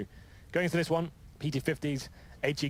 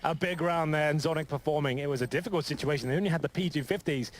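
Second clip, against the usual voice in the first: esports caster; 5 semitones higher than usual. Real, not acted. Co-caster reacting to big round.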